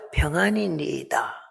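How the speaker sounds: soft, hushed, composed reply; hushed serene reply; calm and quiet. Retold or imitated. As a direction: answer in a quiet calm voice though your heart breaks. Woman's calm answer again.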